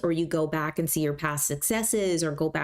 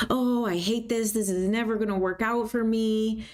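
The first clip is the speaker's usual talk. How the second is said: mock despair; 5 semitones higher than usual. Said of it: "if you say something like".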